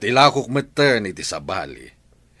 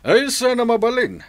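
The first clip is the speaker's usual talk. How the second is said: mocking high exclaim; 6 dB louder than usual. Landlord mocking debtor's plea.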